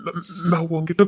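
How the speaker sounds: halting, tripping on first syllables; stammered apology. Acted. Man apologises to Rani for his anger, stammering. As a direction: apologise in broken, hesitant starts.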